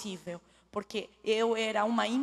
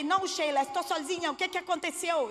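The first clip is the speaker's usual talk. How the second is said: irritated; 7 semitones higher than usual. Retold or imitated. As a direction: snap back at the friend, fed up. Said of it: "E bem irritada". (irritada, respondendo alto e impaciente)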